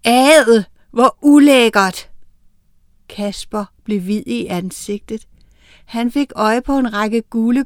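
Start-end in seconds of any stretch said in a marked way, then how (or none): 0.0-2.1 loud, drawn-out cry of disgust; disgusted shout. A narrator's reading. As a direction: exclaim it loudly, stretching the words. Child's disgusted cry after shouting.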